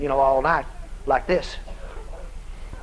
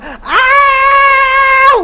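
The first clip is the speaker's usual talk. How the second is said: loud, high drawn-out scream; 9 dB louder than usual. Retold or imitated. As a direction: scream at the top of your voice. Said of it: "began to scream at the top of her voice".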